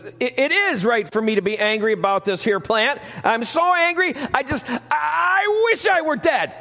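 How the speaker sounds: high, shouting petulant Jonah; preacher hamming up Jonah's angry shout. Acted. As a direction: rant like a sulking prophet. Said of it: "Jonah says".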